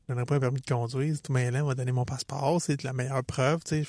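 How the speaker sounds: rushed low inner voice, half to himself; quick inner thought. Retold or imitated. Thinking fast in front of the police officer, rushed and quiet. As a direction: rattle off the reasoning quickly under the breath. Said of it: "Première affaire que je pense".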